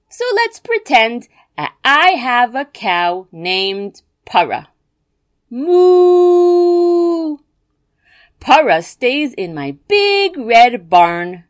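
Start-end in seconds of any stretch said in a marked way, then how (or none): 5.5-7.4 loud, high mooing cow. Pretend. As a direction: moo like a happy cow. Pretends to have a cow.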